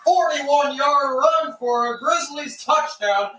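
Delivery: high, loud, drawn-out scoring call; touchdown call. Real, not acted. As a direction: belt out the touchdown like a play-by-play announcer. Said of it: "touchdown call".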